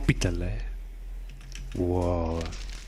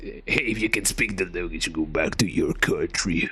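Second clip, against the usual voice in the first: rude bigot; 9 semitones lower than usual. Acted. Player voicing a hostile local telling him to leave in a low sneer.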